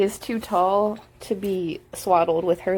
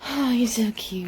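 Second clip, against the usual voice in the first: hushed so as not to startle her; whisper. Real, not acted. Whispers to sleepy baby.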